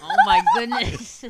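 high, slow, through laughter; laughing exclamation. Real, not acted. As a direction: gasp it out while laughing. Cracking up at the prank call.

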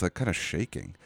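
ordinary talk

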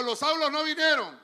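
loud line for the church to repeat; call and response. Shown as a demonstration. Preacher leading the congregation to repeat, loud. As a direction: say it out for them to echo. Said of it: "Diga conmigo".

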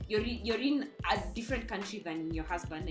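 usual speaking voice

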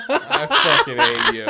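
high, loud, slow laughing cry; to a teasing friend, laughing protest; laughing outburst. Real, not acted. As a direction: shriek it through laughter. Laughing at cohost's joke.

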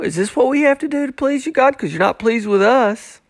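high, fast, overplayed pleading question; over the top. The people pleading with God, overplayed and fast. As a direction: ham up the people's whining to God. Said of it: "It gets a little bit over the top".

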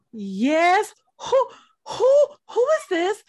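high, drawn-out "who"s, imitating a stunned daughter; mock bewilderment. Daughter asking the mystery caller who it is, imitated high bewilderment. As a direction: stammer it upward in rising disbelief.

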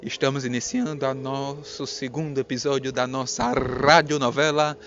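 booming radio host intro; old radio serial announcer. Acted.

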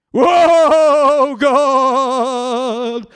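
imitating a church member's loud, high, wailing cry to God; loud wailing prayer. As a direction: belt it out like an over-the-top prayer. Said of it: "where people pray like this?".

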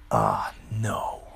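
soft whispered oh no; whispered to himself as the guy walks up; whispered dread. Retold or imitated. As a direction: breathe it out under your breath in dismay. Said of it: "And I'm like".

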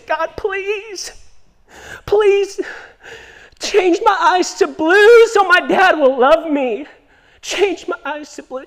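put-on high, slow tearful child prayer; little girl pleading. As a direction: beg God like a heartbroken little girl. Crying girl praying, voiced in story.

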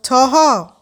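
anxious mother calling a name; high, mildly worried call. A narrator's reading. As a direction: call out in concern. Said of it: "مامان با نگرانی گفت".

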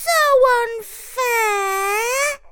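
very high, whiny squeak; a put-upon little mouse. A narrator's reading. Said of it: "squeaked Jimmy".

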